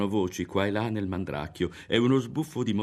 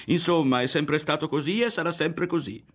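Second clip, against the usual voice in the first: louder, higher, insistent and final; a girl stating it as law to the boy she confides in; emphatic declaration; 10 semitones higher, 5 dB louder than usual. Acted. A girl lecturing a boy, loud and insistent. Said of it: "ripeté".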